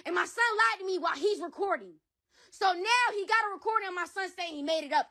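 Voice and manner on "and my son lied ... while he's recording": normal talking voice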